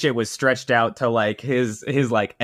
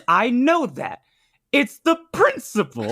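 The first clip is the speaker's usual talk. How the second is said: amazed recognition; 11 semitones higher than usual. Retold or imitated. Husband retells his wife recognising the intruder, high excited blurt. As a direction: blurt out who it is, almost laughing. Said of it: "she goes".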